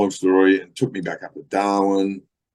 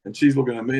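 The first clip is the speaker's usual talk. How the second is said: ordinary talk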